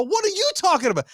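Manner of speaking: high, fast retort; hammed-up argumentative comeback; mock disbelief. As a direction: throw the question straight back, frantic. Acts arguing back at dad.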